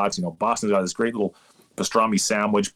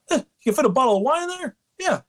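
very high, quick mimicked host; restaurant host impression; faster than usual. Restaurant host planning to slip wine into a purse, high quick mock voice. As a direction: play the host sizing it up, fast and high.

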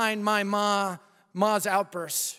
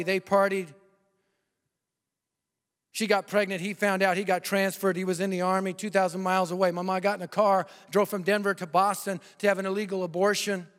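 usual speaking voice

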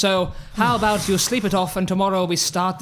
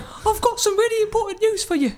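squeaky voice; much faster than usual. Acted. Schmeichel squeaky voice.

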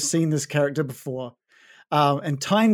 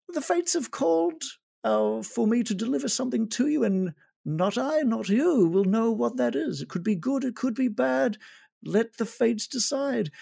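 higher, mysterious fate-speaker; mysterious tabaxi; 8 semitones higher than usual. Acted. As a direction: intone the fates like a sly oracle. Needle delivers prophecy.

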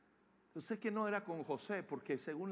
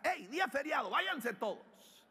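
raised, higher call to the workers; 7 semitones higher, 4 dB louder than usual. Acted. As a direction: send everyone off with a loud call. Acted dismissal of the workers.

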